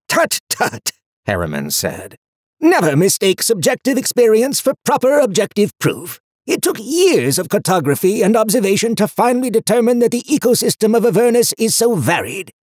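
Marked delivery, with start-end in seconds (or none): 0.1-0.9 fussy. A narrator's reading. Harriman the book scolds. 2.6-12.5 high, loud pedantic book voice; fussy. A narrator's reading. Harriman the book lectures.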